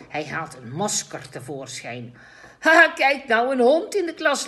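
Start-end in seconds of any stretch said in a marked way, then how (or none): 2.6-4.5 put-on loud, high laughing child. A narrator's reading. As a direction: laugh it out, amused. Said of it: "lacht Habib".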